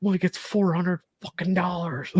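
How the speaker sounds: high, strained mock yell; retold hollering. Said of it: "then I'm hollering for her".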